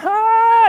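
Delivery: loud, high "ah" of cold shock; ice-bath yelp. Acted. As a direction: yelp as if plunged into icy water. Mimics reaction to ice baths.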